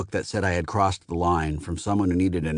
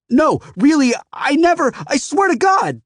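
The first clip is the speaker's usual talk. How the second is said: very high, frantic near-tears pleading; babbling; 7 dB louder than usual. A narrator's reading. Scared teen begs the customer, babbling near tears. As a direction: babble in panic, close to tears. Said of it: "I was babbling".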